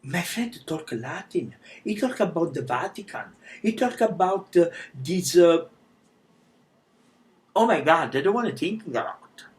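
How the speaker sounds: scared call to mom. Retold or imitated. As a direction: sound frightened while telling mom on the phone. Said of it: "I'm so scared".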